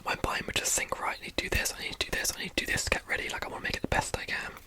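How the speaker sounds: ordinary delivery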